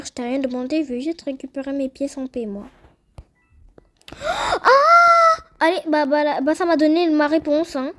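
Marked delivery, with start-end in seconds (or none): 4.2-5.4 loud, piercing sustained scream; high-pitched scream. Real, not acted. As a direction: let out a very high, loud shriek. Sudden outcry while playing.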